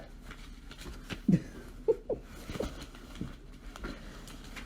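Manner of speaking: a long, high, breathy laugh. Real, not acted. Host laughing to her live viewers at herself, long and airy.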